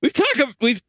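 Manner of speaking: trying to talk but cracking up; laughing through it. Real, not acted. Cracking up while introducing Loch Ness to cohosts. Breaking up laughing mid-sentence.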